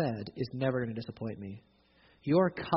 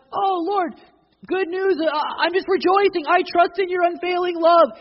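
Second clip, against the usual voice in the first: high, loud mock-joyful prayer; mock rejoicing; 10 dB louder than usual. Not the psalmist, a mock joyful prayer to the Lord. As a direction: gush like a delighted believer.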